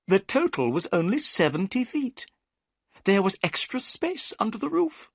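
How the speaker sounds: high, eager gentleman's voice; Thaddeus Sholto. A narrator's reading. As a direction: lift it up as the anxious host. Thaddeus tells the treasure story.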